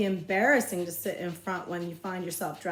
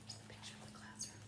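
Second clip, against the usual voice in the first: soft unvoiced aside; whispering; 22 dB quieter, faster than usual. Real, not acted. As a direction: whisper it to the listener.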